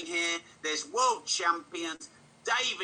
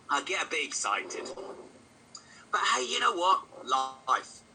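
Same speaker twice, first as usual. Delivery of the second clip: ordinary talk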